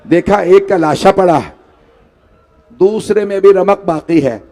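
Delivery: chanted, grieving storyteller's cadence; mourning recitation. Acted. Preacher recites the martyrdom to the gathering, in a mourning chant. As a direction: recite the tragedy to move the mourners. Majlis narration continues.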